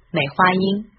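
normal talking voice

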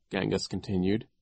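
ordinary delivery

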